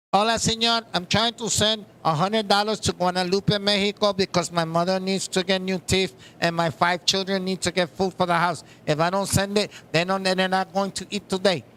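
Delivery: lightly voiced Mexican accent, pleading a little; a hint of a Mexican accent, asking to send money home. Caller asks the translator to send money, in a light Mexican accent. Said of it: "but with their accent, it's funny".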